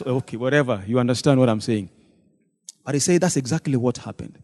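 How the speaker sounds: ordinary delivery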